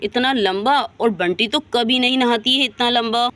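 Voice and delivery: ordinary delivery, nothing marked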